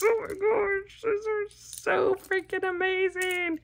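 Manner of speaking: very high gushing coo. Real, not acted. Crafter cooing at Mickey cocoa mugs, high and sweet.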